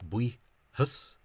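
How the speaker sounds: "buihus" slowly, first part squashed into one syllable; misspelling pronunciation. Shown as a demonstration. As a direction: spell out the rejected "buihus" in slow pieces. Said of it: "that's the Caidan na Figiúil misspelling".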